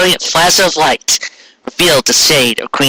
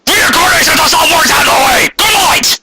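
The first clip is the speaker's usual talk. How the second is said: mock outraged shout. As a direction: shout it out like storming off. Mock outburst with music.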